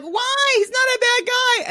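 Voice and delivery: very high-pitched protesting whine; mock defensive girlfriend. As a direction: imitate a friend shrilly defending her man. Acts out friend defending Drew.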